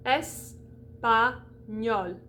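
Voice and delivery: "Espagnol" very slowly as "es-pa-gnol"; syllable by syllable. Shown as a demonstration. Teacher splits the word, "Espagnol" syllable by syllable. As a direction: break "Espagnol" into separate syllables, open E first. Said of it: "that's one syllable".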